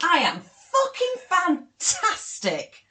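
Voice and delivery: loud, high, drawn-out self-praise; hammed-up triumph, stretching the words out slowly; grandiose boasting. Laughing at own two extremes, mock-grandiose boast.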